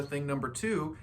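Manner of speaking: ordinary delivery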